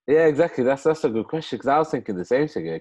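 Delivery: high, loud burst of eager agreement; excited agreement. Real, not acted. To the host who asked his question, excited agreement. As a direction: jump in keen to say the host was right.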